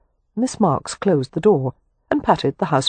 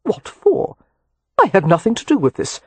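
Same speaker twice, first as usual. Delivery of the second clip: sharply higher, affronted question; indignant; 4 semitones higher than usual. A narrator's reading. Leo, accused by the doctor, asks indignantly. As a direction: throw the blame right back at him. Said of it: "Leo was indignant".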